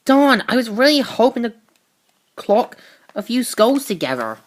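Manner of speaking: spoiling for a fight; fired-up heroine. Retold or imitated.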